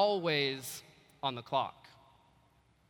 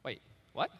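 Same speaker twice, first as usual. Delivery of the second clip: confused; 5 semitones lower, 4 dB quieter than usual. Retold or imitated. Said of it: "kinda confused".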